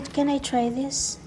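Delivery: ordinary talk, nothing marked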